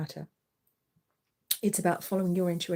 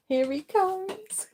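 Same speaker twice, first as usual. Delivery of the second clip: much higher, slow, playful sing-song; 12 semitones higher, much slower than usual. Real, not acted. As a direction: announce the cat gleefully. Cat arriving, said twice.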